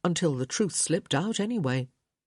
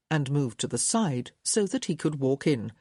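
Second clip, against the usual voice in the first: normal talking voice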